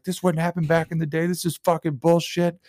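mock indignant complaint; imitated flipping out. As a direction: rant like a fed-up comic. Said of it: "he was, like, flipping out over it".

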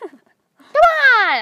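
high, loud call to a friend. Acted. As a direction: shout it out eagerly. Leading friend, calling out.

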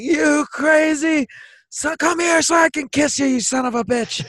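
high-pitched elated holler; acted giddy yell, way up high; laughing, giddy. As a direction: call out to a pal in delirious affection. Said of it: "I'm just laughing".

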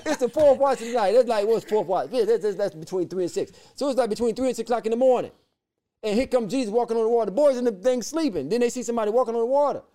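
preacher worked up telling the story; excited preaching. Real, not acted. Preaching climax, rising excitement.

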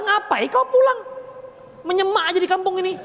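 mother scolds the son who came home empty-handed, mock voice; scolding village mother. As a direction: play the nagging mum at the door. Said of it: "misalnya begitu".

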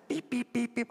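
high, squeaky bleep noises in place of swearwords; mock bleeped swearing. Preacher mocking a driver's swearing, high beeps. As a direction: squeak out the censored curses.

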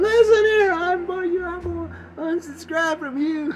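high, mock huffy listener voice; annoyed listener. Lone Cincinnati listener threatening to unsubscribe, high huffy voice. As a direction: play a fed-up fan quitting. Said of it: "my one listener in Cincinnati is going".

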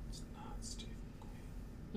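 slow hushed whisper. Real, not acted. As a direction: whisper it slowly to your partner. Guessing quietly to teammate.

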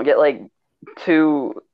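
usual speaking voice

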